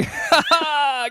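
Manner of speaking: loud, high-pitched cackle at own joke; big delighted laugh; high burst of laughter. Real, not acted. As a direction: crack up at your own punchline.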